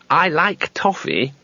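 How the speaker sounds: slow, mildly defensive; defensively. A narrator's reading. Two Flower defends himself to Rincewind, lightly defensive. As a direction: justify yourself gently. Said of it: "said Two Flower defensively".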